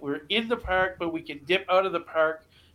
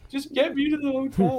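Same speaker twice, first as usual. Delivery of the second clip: pleading; 8 semitones higher than usual. Retold or imitated. Begging dad to leave.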